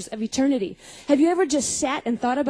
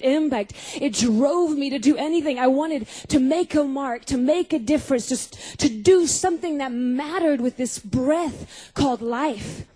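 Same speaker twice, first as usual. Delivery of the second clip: usual speaking voice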